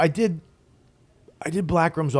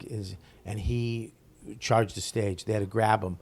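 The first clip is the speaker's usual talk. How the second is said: usual speaking voice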